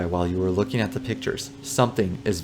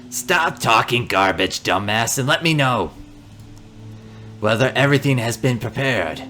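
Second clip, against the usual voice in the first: put-on gruff old man. Old man scolding son.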